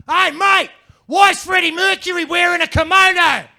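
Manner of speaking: shouted high and loud, a jeering Australian bloke; loud Aussie heckle. Retold or imitated. An Australian heckler shouting at the comedian. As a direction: bellow it across the street like a heckler.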